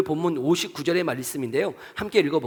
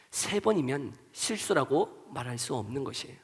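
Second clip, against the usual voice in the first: ordinary talk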